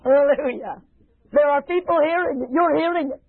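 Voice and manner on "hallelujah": ordinary talk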